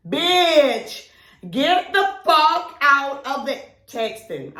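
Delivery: high, loud, slow alarm; alarmed yell. Retold or imitated. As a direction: bark a warning at her friend. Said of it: "The first thing my brain gonna say is".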